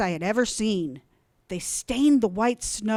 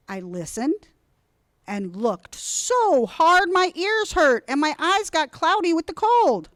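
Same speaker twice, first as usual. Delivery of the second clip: ordinary delivery, nothing marked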